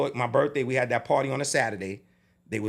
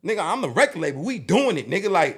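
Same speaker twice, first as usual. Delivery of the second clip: retold heated outburst, higher and louder; angry insistence; 8 semitones higher, 5 dB louder than usual. Label boss overrules manager on the show, angrily. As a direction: lay down the law, worked up. Acted quarrel over the cancelled show.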